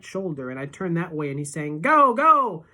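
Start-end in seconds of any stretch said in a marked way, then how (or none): 1.8-2.6 loud, much higher shout; urgent shout. A narrator's reading. Boy on shoulders urging his friend to run: loud urgent shout. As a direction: drive him forward. Urgent steering while fleeing.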